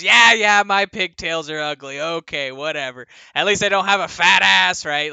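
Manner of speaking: booming. Acted. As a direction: boom it out across the tavern. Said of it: "booms out over the crowd".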